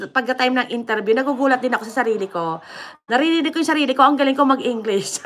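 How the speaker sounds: normal talking voice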